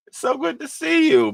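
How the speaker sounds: sky-high happy hello; high delighted squeal. Real, not acted. Repeats greeting, second take higher.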